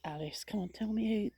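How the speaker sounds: soft, half-whispered grumble; muttered coaxing. Real, not acted. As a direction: mutter impatiently at an unresponsive gadget. Muttering to her device for a name.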